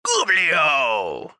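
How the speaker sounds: high goblin call falling away; goblin crowd cry. Acted. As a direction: holler it like a whole goblin mob. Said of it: "you just hear everybody in unison go".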